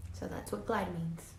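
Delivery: lively, expressive read-aloud voice; reading to children. A narrator's reading. Teacher reading a story to her class, lively and expressive. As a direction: bring the picture book alive for the class.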